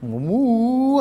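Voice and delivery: usual speaking voice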